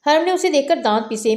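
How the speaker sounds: ordinary delivery, nothing marked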